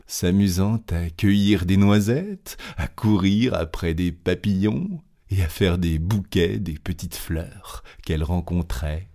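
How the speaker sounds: usual speaking voice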